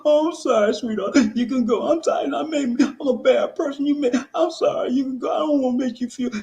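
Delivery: mock a man grovelling near tears; hammed-up pleading boyfriend; mock guilty simp. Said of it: "Don't start crying 'cause she make you feel so guilty".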